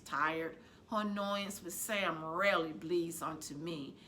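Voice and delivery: plain reading voice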